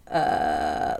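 very low creaky fry; vocal fry. Shown as a demonstration. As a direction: drop into a creaky rattle. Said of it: "like, that's vocal fry".

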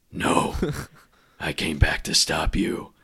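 low, growled Batman reply; Bale Batman voice. Retold or imitated. Podcaster voicing Batman answering Bane. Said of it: "It's a great Bale voice".

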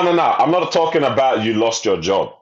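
ordinary delivery

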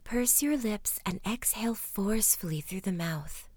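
soft, soothing meditation voice; guided relaxation. Acted. Narrator settling listeners for rest, soft calming guidance. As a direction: gently lead the breathing, hushed and unhurried. Leads bedtime breathing exercise.

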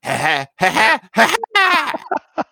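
rising crazed cackle climbing very high; manic cackle. Retold or imitated. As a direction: laugh like he's snapped. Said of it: "and he's like".

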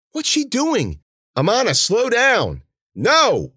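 high, loud alarmed yell. A narrator's reading. Girl's companions calling after her as she runs ahead, yelling. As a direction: shout it out high and urgent. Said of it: "Amana yelled".

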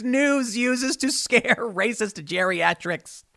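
slow, laughing through the words. Real, not acted. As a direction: chuckle at the absurd line. Laughing at the article's jab.

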